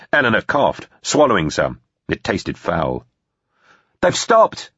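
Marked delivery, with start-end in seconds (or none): 4.0-4.7 high-pitched call. A narrator's reading. Susie telling the group the lasers stopped, calling out. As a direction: call the news out across the water. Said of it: "Susie called out".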